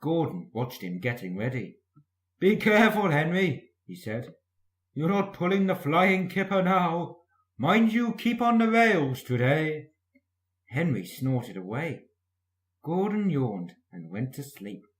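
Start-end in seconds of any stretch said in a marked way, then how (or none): 2.4-9.9 louder, higher mocking caution; mocking warning. A narrator's reading. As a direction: Gordon taunts Henry before the express. Gordon warns Henry mockingly.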